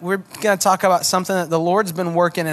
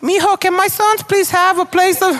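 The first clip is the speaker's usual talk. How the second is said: high, louder Tejano mom voice with a Spanish lilt; 11 semitones higher, 3 dB louder than usual. Retold or imitated. South Texas mom begging Jesus for her sons, in a high Tejano mom voice. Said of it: "your mom might sound like this".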